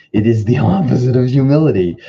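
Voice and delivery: real amused laugh while repeating. Real, not acted. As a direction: laugh it out as you repeat yourself. Laughs at own repetition.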